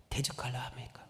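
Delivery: hushed rhetorical question; whispering. Acted. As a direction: whisper it with menace. Preacher drops to whisper.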